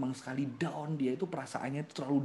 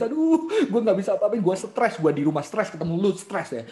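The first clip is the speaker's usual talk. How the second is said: loud exasperated outburst, easing off; stressed-out boyfriend; 6 semitones higher, 8 dB louder than usual. Acted. Boyfriend to crying girlfriend, loud exasperation. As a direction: act out a fed-up complaint.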